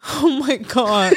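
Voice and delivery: slow laughing oh my God. Real, not acted. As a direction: laugh out the words, slowly. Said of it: "I'm just laughing".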